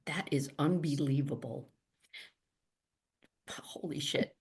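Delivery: whispered disbelief; awed whisper. Real, not acted. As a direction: whisper it in real awe. Hears thirty years experience.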